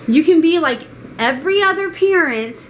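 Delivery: high, emphatic mocking imitation of a rude clerk; rude, condescending. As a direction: scold a guest with rude finality. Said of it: "And she was very rude about it".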